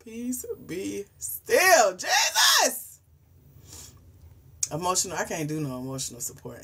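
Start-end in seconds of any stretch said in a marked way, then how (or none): 0.0-3.0 very slow then high loud outcry; loud crying shout; desperate prayer. Real, not acted. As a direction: cry out to Jesus to calm down. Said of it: "calming herself, praying".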